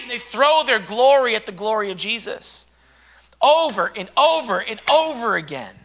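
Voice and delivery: usual speaking voice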